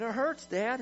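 put-on high, pleading child's whine; mock crying. Son pleads with his dad about his ear, mock crying. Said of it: "And he's crying".

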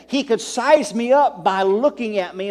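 ordinary talk

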